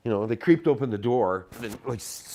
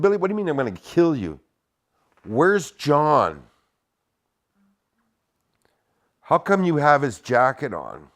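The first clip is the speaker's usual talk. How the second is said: exasperated questioning; 6 semitones higher, 7 dB louder than usual. Retold or imitated. Confronting Billy, exasperated.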